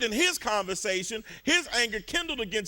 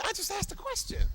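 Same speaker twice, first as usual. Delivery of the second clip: a first try, already raised high; high, innocent protest; 13 semitones higher than usual. Shown as a demonstration. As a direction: act hurt and blameless. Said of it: "Come on, just say, say".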